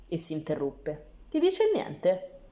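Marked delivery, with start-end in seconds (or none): 1.3-2.4 cryptic question. A narrator's reading. Old man asks cryptically.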